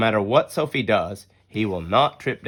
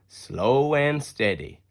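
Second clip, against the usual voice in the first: ordinary delivery, nothing marked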